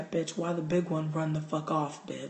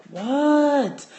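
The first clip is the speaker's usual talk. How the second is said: much higher and louder, drawn out; loud exasperated what; 10 semitones higher, 8 dB louder than usual. Retold or imitated. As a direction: yell it out, fed up. Frustrated second answer.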